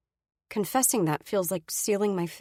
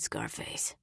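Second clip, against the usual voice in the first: whispered, much softer nickname; menacing whisper; 11 dB quieter than usual. Acted. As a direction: breathe it as a quiet threat. Brady deadly calm.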